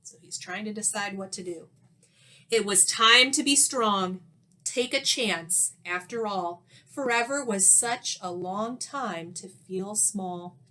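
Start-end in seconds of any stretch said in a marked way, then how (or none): none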